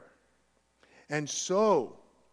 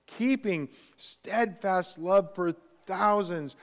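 louder, ringing proclamation of the verse; proclaiming scripture; 3 semitones higher, 5 dB louder than usual. Acted. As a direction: declare it like a herald before the people. Proclaims scripture quote aloud.

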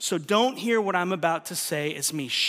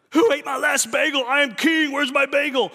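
loud, higher shout of a self-crowned bagel king; mock petty king; 7 semitones higher, 7 dB louder than usual. Pastor mocking the selfish heart, as a petty shouting king. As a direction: bellow it like a spoiled little king. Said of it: "Let's compare the two".

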